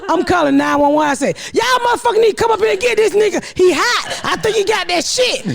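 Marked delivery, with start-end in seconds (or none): 1.5-5.4 fast, high, panicked rant; frantic retold call. Mother on the phone to 911, fast frantic rant.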